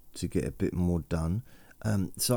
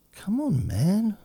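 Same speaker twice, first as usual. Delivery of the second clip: exasperated squeak; slower than usual. Retold or imitated. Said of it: "complaining about close talkers".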